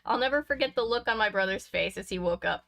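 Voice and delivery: ordinary delivery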